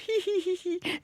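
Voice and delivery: high mocking hee-hee laugh; imitated hyena giggle. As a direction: mimic a silly little giggle.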